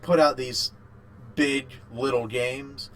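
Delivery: usual speaking voice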